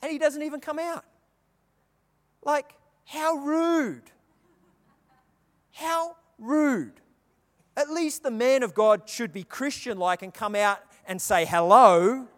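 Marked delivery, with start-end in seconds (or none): 2.4-7.0 higher, drawn-out mock offended; mock indignation. Preacher jokingly scolding the prophet's manners, mock offended. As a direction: play it as fake outrage.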